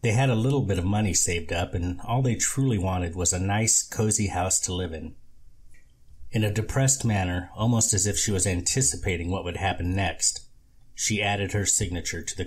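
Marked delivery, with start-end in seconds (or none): none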